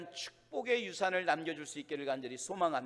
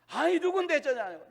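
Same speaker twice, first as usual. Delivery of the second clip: high, louder, drawn-out mock irritation; retold irritation; 8 semitones higher, 6 dB louder, slower than usual. Angry man on the phone to an unknown caller, mock irritation. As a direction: demand it in mock annoyance. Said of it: "너무너무 화가 나게".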